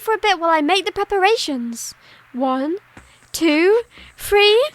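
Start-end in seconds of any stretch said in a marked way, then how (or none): none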